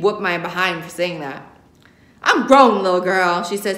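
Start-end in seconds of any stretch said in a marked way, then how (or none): 2.2-3.4 loud, sassy put-down; sassy rebuke. Acted. Grandma telling granddaughter to mind her business, sassy. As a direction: put the nosy girl in her place.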